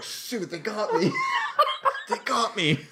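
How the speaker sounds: slow, laughing mock defeat; laughing imitation. Mimicking the British man walking off, laughing. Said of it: "walked away like".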